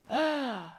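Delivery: long high exhaled sigh; resigned sigh. Acted. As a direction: sigh as if giving in. Brown bird gives in.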